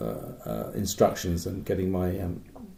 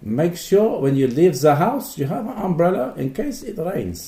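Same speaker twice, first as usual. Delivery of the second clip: mock German accent; 7 semitones higher, 4 dB louder than usual. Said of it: "is that more German?".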